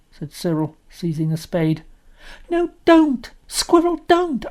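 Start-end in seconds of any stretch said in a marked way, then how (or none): 2.5-4.5 high, loud desperate plea; pleading cry. Acted. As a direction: beg them to stop. Anthea begs brothers stop.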